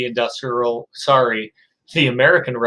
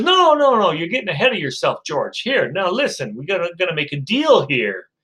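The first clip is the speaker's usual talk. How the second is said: pushy salesman; 3 semitones higher than usual. Acted. Seller corrects George.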